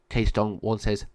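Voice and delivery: plain reading voice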